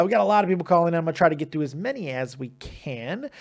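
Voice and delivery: normal talking voice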